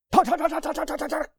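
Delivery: high rapid-fire staccato chatter; imitated machine-gun fire on one high pitch; machine-gun talk. Shown as a demonstration. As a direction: rattle the word off like a machine gun. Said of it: "like a machine gun".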